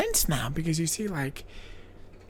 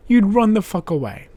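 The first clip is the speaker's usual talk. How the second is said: normal talking voice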